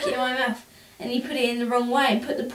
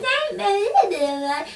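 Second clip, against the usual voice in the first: mocking brush-off; 10 semitones higher, 4 dB louder, much slower than usual. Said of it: "you were like".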